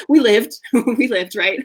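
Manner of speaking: laughing through the words; real laugh as she says it. Real, not acted. Laughing at her own story.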